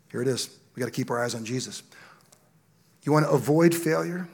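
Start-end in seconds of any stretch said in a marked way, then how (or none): none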